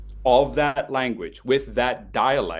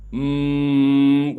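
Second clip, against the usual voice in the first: steady demo hum; 4 dB louder than usual. Shown as a demonstration. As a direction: hum along, mouth closed. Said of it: "hum with me".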